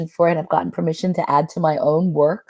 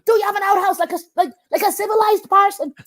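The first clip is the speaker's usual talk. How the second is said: little man voice; 13 semitones higher than usual. Retold or imitated. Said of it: "And he says".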